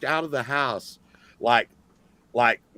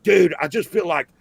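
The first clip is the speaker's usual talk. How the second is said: acted tripping cousin; 4 semitones higher, 5 dB louder than usual. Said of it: "and he's like".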